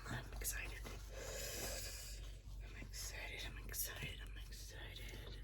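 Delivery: whispered chant of I'm excited; real giddy whisper to herself. Real, not acted. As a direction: hold in the thrill, whisper it over and over. Repeats excitement over necklace.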